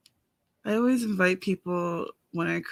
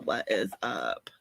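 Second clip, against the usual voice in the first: What is up? dropped much lower and slowed; mock deep voice; 12 semitones lower, 3 dB quieter, slower than usual. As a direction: play it as a big low slow dude greeting. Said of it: "What is up, Kyle".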